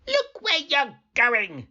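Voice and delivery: high, slow, huffy wolf voice; wolf huffs at the girl. A narrator's reading. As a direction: scold her like an offended wolf. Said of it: "said the wolf huffily".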